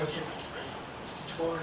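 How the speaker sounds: voiceless hunter's whisper to his partner; urgent whisper. Retold or imitated. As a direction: hiss the order without voice. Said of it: "So I'm like".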